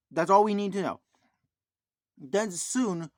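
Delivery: ordinary delivery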